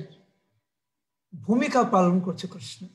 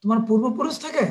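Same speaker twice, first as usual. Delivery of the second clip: raised, emphatic scolding; mother telling off her son in anger; angry mother; 3 semitones higher than usual. Acted. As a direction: demand answers from a misbehaving child. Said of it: "মা যশোদা রেগে রেগে বলছে".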